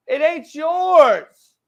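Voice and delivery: high, loud, drawn-out retort; angry retort. Real, not acted. Host telling critics the opinion is his, angry. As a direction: stretch it out with real heat, pitched high.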